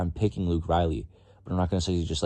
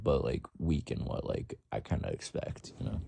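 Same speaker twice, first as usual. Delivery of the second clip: normal talking voice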